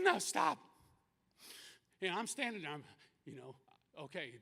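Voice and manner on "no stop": high, loud cry to stop; acting out a pained protest; pained plea. Daughter in pain begs to stop.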